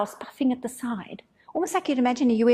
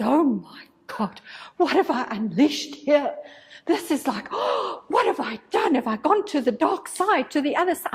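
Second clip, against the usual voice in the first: retold panic; 3 semitones higher than usual. Healer, panicking inwardly at her group: mock alarmed gasp. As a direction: replay the panic with a gasp in the middle. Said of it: "I was panicking".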